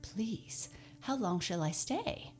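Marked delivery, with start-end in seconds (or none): 0.0-0.7 whisper. A narrator's reading. Scarecrow timid plea.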